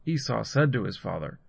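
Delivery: plain reading voice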